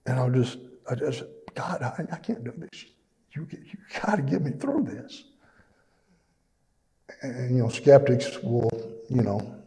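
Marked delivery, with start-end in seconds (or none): 1.6-5.2 higher and softer, a pleading retold prayer; retold desperate prayer. Grieving father at the funeral home pleading with God, quiet and high. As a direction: beg God quietly with the voice lifted.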